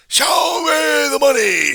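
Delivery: high, slow, drawn-out yell; shouted demand. Acted. Mobster demanding from the other guy, long high shout. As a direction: holler it with every word stretched. Acted demand in mock confrontation.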